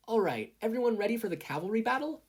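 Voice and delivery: put-on excited hero kid rallying his team; Izuku voice. A narrator's reading. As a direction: call out to the team, keen and bright.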